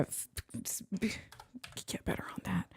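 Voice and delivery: breathy whisper to himself; whispered aside. Real, not acted. Editor scolds himself softly, in a whisper. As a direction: say it under your breath, half to yourself. Muttered self-reproach while editing.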